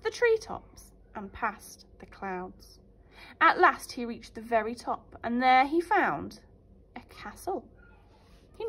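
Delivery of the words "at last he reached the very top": plain reading voice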